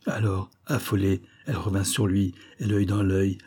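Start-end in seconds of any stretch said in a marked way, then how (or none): none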